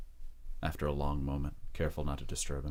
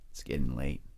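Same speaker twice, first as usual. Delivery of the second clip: a calm threat; lightly menacing man; 3 semitones lower than usual. Acted. Rose King warning Jesse in the dark stairwell.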